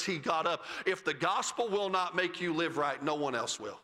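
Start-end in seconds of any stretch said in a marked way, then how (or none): none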